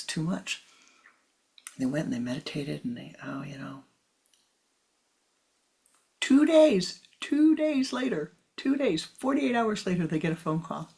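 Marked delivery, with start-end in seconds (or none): 6.2-10.1 excited emphasis. Real, not acted. Emphatic story climax.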